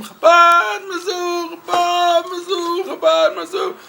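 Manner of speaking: high, slow, loud chant of "Chabad Mizzou"; mock screaming of the slogan; imitated screaming. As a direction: scream it into his ear. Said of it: "he's screaming at him".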